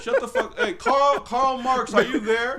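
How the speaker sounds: high-pitched jokey summons to someone absent; playful, high-pitched hailing over someone talking; mock calling out. Hollering for Karl Marx over friend's speech.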